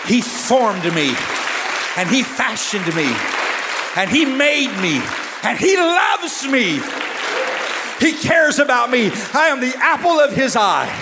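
high, impassioned sermon delivery; high-pitched fervent testimony from the pulpit; full-force preaching. Acted. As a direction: preach it in long, ringing declarations.

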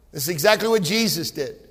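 usual speaking voice

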